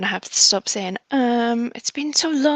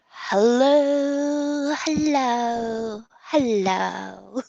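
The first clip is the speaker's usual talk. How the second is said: drawn-out hello; much slower than usual. Pretend. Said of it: "not gonna say it the same way".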